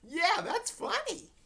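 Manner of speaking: high, loud, slow jeering with a laugh; mock the dog's death with a braying laugh. A narrator's reading. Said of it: "Trudy brayed a laugh".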